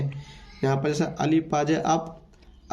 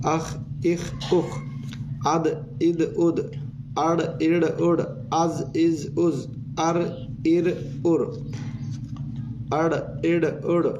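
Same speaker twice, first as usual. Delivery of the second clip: syllable after syllable drawn out slowly; slow syllable drill; much slower than usual. Shown as a demonstration. Teacher drilling pupils through syllables, slow. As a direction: drill each set slowly and clearly. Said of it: "ज़बर की आवाज़ ऊपर को होती है".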